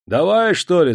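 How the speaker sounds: high, loud call down from the tower; shout from above. A narrator's reading. A man on the tower yells for the hanging to start. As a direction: yell it impatiently. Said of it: "закричал невидимый человек с вышки".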